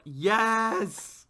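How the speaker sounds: loud, high cheer of delight; excited yes. Retold or imitated. Co-host mimicking thrilled fans hearing her voice, high excited cheer. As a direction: cheer it like an ecstatic fan.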